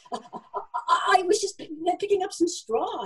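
high, stammering excuse; small flustered mouse caught out; nervous stammer. A narrator's reading. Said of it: "he stammered".

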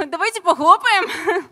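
laughing as she calls for applause. Real, not acted. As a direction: giggle through the words. Presenter laughs asking for applause.

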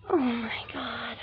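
soft crying after a gasp; tearful. Real, not acted. Still upset while being comforted, soft tearful moan. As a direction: let it fade into a quiet sob.

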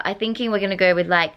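ordinary talk, nothing marked

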